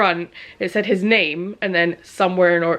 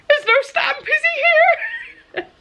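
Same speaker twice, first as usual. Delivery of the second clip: shocked realisation; slower than usual. Retold or imitated. Wife imitating husband discovering his best friend is here, high shocked shout. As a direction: squeal it out as it dawns on you.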